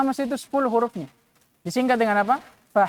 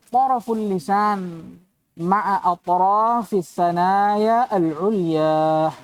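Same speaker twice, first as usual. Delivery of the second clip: slow Arabic recitation; 3 semitones lower, much slower than usual. Acted.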